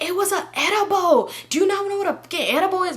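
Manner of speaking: high-pitched indignant mimicry; pissed friend, imitated. Friend insists it was an edible, mock pissed. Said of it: "So she just so pissed".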